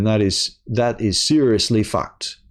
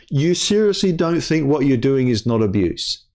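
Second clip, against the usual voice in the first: usual speaking voice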